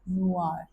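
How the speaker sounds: नुआर with the final r sounded; नुआर, final r heard; French noir. Shown as a demonstration. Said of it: "इस word को आप बोलोगे".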